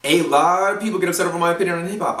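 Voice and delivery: rattled off quickly; really fast. Real, not acted. Telling viewers about upset reactions, really fast. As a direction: rush the whole sentence in one run. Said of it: "I said that really fast".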